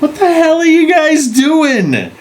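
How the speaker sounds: very high, drawn-out mock yell; appalled policeman. Police finding kids tied up in the pool, appalled yell. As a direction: screech it in comic disbelief.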